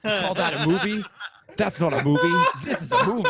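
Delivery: hammed-up Australian accent, rising high and loud; Crocodile Dundee Australian. To the hosts, joking about Crocodile Dundee, in a hammed-up Australian accent.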